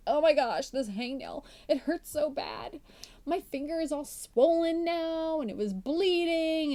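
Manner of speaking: milk a hangnail for pity, half-joking; mock whining. Podcast host mocking how we overdramatize a hangnail, hammed-up whine. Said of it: "We get all whiny and complaining".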